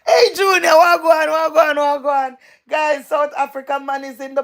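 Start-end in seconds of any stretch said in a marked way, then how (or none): 0.1-2.4 loud, high hail to a friend; happy shouted wagwan; excited greeting. Real, not acted. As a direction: call out to him as he walks in. Greeting a guest who just arrived.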